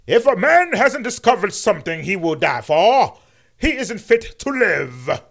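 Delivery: booming mock King sermon, fixed line; MLK impression, corrected. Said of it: "How you like my M L K impression now".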